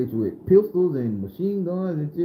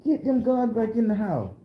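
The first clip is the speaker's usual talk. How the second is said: Grandma giving orders; 7 semitones higher than usual. Retold or imitated. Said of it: "Grandma ordering".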